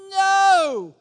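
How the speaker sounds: a loud, high, sharp "No.". Shown as a demonstration. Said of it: "I would go like this".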